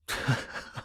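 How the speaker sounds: hushed breathy snicker; low-volume, airy chuckle; soft laugh. Acted. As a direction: let out a quiet little laugh. Laughs, then says sorry.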